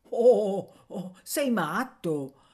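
loud oh, slow mocking; scoffing. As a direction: laugh off the idea. Boy scoffs. (sbeffeggiando, lento)

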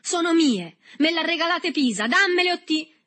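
very high, loud protest; furious shout. A narrator's reading. Berto claims his cigarettes, yelling furiously. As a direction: yell it, building to a threat. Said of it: "gridò Berto avventandosi furioso".

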